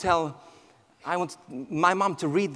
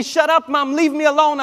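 put-on louder, higher teenage snap at mom; re-enacted teenage yell; retold angry outburst; 7 semitones higher, 5 dB louder than usual. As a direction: raise your voice as if shutting mom out. Teen snapping at mother at door.

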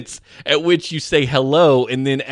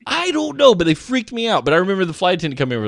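normal talking voice

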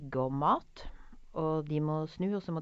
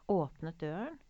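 usual speaking voice

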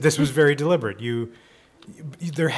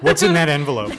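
mock accusation; 6 dB louder than usual. Jokingly accuses guest of terrorism.

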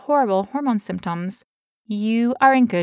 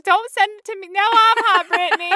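much higher, hammed-up protest about being hot; mock whiny complaint; 12 semitones higher, 3 dB louder than usual. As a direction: play up the heat drama in a high voice. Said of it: "I'm like".

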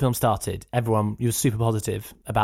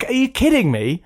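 higher, incredulous; mock disbelief; 11 semitones higher, 4 dB louder than usual. Real, not acted. To his co-host, who has never heard of it, high mock disbelief. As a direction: act amazed he doesn't know it.

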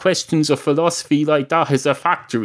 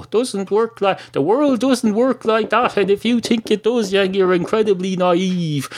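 raised-pitch lecture to naive listeners; worked-up rant; 7 semitones higher than usual. Real, not acted. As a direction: climb up in pitch as you scold them. Ranting at listeners.

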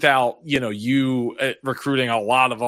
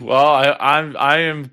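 ordinary delivery

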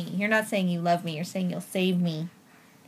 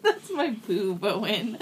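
slow, laughing while tickled; squealing laugh under tickling; being tickled; 5 semitones higher, much slower than usual. Real, not acted. Child tickling mother.

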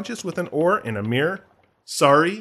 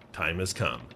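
Barbossa quote; 10 semitones lower, 11 dB quieter than usual. Retold or imitated. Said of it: "lifted by saying".

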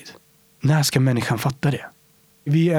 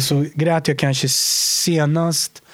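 slow confession; slower than usual. Real, not acted. Confessing crying at film.

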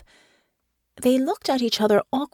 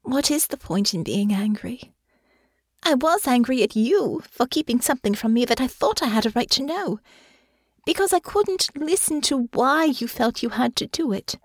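higher, composed character voice; Honor's voice; 6 semitones higher than usual. A narrator's reading. Honor explains letting go of anger, serene. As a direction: reason it out calmly as Honor. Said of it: "a small serene gesture".

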